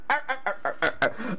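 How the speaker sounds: soft, staccato cartoon cackle; repeated put-on "arr" chuckle; Popeye laugh. Parodying the critic's laugh over noodles, cartoon chuckle. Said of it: "there's the Popeye laugh".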